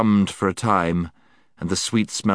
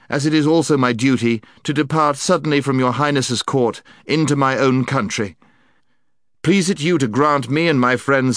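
noble prince; 7 semitones higher, 5 dB louder than usual. Acted. Prince's formal declaration.

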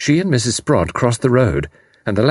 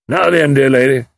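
loud, hearty major, pitched up; commanding major; 6 semitones higher, 5 dB louder than usual. A narrator's reading. Major addresses distraught mother, taking control. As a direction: take charge with a loud address.

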